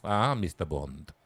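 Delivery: put-on slow, low European villain accent; generic European villain accent. Villain greets Bond, in a mock European accent. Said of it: "in a generic European accent".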